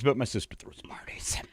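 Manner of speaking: hushed, unvoiced whisper; mock whispered aside. Sibling giving away the sister, in a mock whisper. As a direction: whisper it like a secret.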